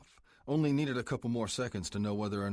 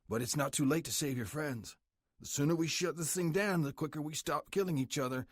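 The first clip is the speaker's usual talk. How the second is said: menacing biker boss; 6 semitones higher than usual. Acted. Club president threatening the cartel prisoner, menacing. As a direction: lean in and threaten him coldly like the boss.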